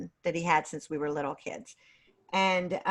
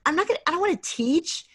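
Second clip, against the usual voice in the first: higher, quicker mock-whiny refusal; reluctant protest; 9 semitones higher, faster than usual. Retelling her young self's refusal to teach, in a higher, quicker mock whine. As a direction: retell brushing off the palm reader's prediction. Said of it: "I was like".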